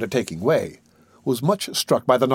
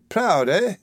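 sneering; 7 semitones higher than usual. A narrator's reading. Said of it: "exclaimed Mister Sowerberry with a sneer".

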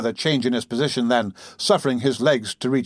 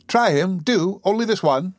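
lightly voiced, higher coaxing girl; fond serving girl; 7 semitones higher than usual. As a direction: offer it sweetly in a higher pitch. Voices Charlotte coaxing Noah.

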